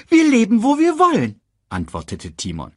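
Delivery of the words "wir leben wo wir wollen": high, quick cartoon meerkat; chirpy little sidekick voice. Timon answers.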